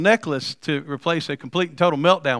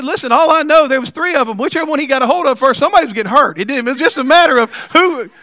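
higher, louder retelling to the wife; telling the wife; 6 semitones higher, 4 dB louder than usual. Retold or imitated. Telling his wife the muggers would get hurt, raised and excited. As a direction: lean in like it's obvious. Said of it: "I told my wife".

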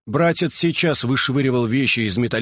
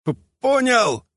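high, strangled stammer; choked gasp; 14 semitones higher, slower than usual. A narrator's reading. Brother being strangled by Alpha answers, high choked croak. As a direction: squeeze it out slowly, fighting for air. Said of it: "прохрипел тот".

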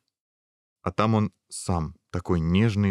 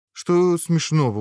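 higher, louder, slow puzzled question; surprised; 9 semitones higher, 5 dB louder, slower than usual. A narrator's reading. Prince baffled by the princess's laughter, surprised. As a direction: ask in drawn-out bewilderment. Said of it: "удивился принц".

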